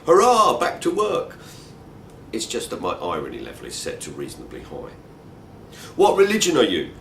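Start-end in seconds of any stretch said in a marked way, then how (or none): 0.1-1.4 high, loud mock hurrah; mock cheer. Soldiers leaping into jeeps at midnight, hammed-up cheering shout. 6.0-6.9 high, loud demanding shout. Retold or imitated. Armed young soldier shouting the question at the walker. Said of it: "shouts one of the four".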